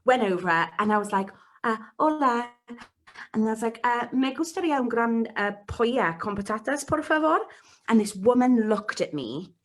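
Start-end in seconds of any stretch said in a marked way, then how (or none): 1.6-2.5 timid hola; nervous tourist hello; unsure Spanish greeting; hesitant hola. Retold or imitated. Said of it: "full of gusto". 3.8-7.4 put-on hesitant English-accented Spanish, rising to a high "favor"; nervous Brit trying Spanish; an English-accented order; British accent Spanish. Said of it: "I went really British".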